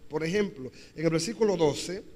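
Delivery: ordinary talk, nothing marked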